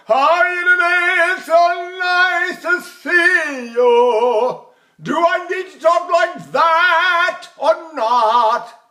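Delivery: very high, slow sung talking; high operatic speech. Shown as a demonstration. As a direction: sing the words in high voice. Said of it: "I can go".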